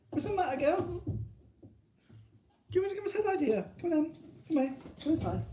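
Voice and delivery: very high, sing-song pet voice; baby talk to dog. Pretend. Talking to his Jack Russell, squeaky baby talk.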